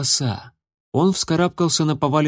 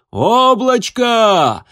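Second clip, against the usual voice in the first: long, high, loud drawn-out roar; roaring call; 9 semitones higher, 6 dB louder, much slower than usual. A narrator's reading. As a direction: roar the name into the storm. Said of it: "проревел он до боли".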